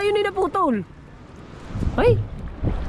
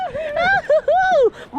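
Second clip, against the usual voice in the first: very high, drawn-out laugh; high whooping laugh; 12 semitones higher than usual. Real, not acted. Fisherman delighted at the catch, whooping laugh.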